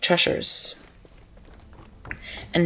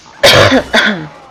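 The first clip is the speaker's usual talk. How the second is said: loud hacking cough; coughing fit; 2 semitones higher, 12 dB louder than usual. Real, not acted. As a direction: cough hard from a sore throat. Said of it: "sore in my throat".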